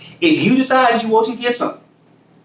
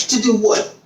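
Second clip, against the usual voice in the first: ordinary talk, nothing marked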